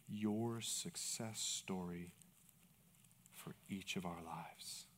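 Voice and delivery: low, very soft, slow praying; quiet closing prayer. Real, not acted. Pastor praying for the men, hushed. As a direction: pray slowly and softly, with long pauses.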